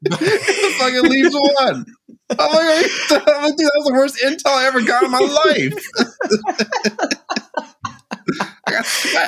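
can't get the words out for laughing. Real, not acted. Laughing retelling a bad bet.

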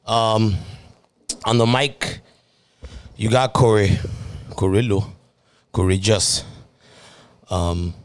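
panting after a run; out of breath, hosting anyway; audible breathing. Real, not acted. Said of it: "sorry, I'm panting".

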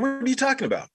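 high, incredulous retort; baffled, defending himself. Retold or imitated. Son baffled by his mother's scolding, high and incredulous.

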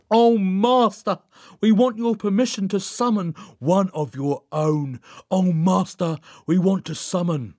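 high, drawn-out plea to a dark master; ritual invocation. A narrator's reading. Joan asks the Master's permission to summon, ritual invocation. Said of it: "waited until he finished and then continued".

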